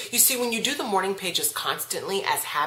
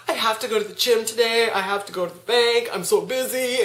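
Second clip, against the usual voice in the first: a touch higher, imitating frantic racing thoughts; mock freaking out; 4 semitones higher than usual. Waking up anxious about errands, mildly mock frantic. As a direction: play the panicked to-do list, lightly hammed. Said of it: "and you're, like, freaking out".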